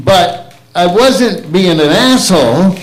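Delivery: ordinary talk, nothing marked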